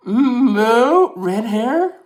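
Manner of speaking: high, loud disbelieving question; can't believe the suggestion; incredulous surprise. Real, not acted. Reacts to a chat comment.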